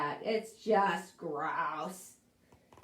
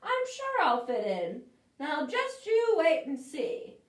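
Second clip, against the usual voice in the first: lightly voiced hopeful pumpkin, a bit higher; bright, eager little pumpkin; cheerful pumpkin; 6 semitones higher, 4 dB louder than usual. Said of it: "said Christopher P".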